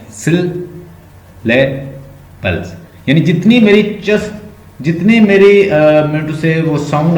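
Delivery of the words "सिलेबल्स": सिलेबल्स in slow, separated chunks; syllable by syllable. Shown as a demonstration. Teacher has students count syllables, slowly broken up. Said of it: "count करें।".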